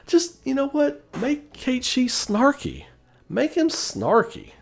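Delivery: drawn-out, high squeaky plea; high-pitched pleading. Real, not acted. Fan begging writers to make the toy snarky, high drawn-out plea. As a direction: stretch it out high, dragging the key words.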